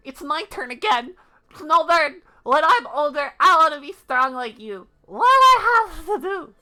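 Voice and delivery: put-on high, loud eager kid; annoying kid voice. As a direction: demand it like a bratty child. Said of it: "my annoying kid voice".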